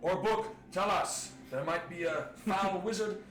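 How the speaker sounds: guard's proclamation. Acted. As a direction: call it out for the whole street. Guard addressing the townspeople.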